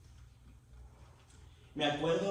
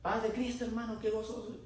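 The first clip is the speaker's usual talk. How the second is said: saludo agudo de hermano contento; saludo gozoso imitado; 7 semitones higher than usual. Retold or imitated. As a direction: imita un saludo efusivo, con la voz más alta. (saludo alegre y agudo)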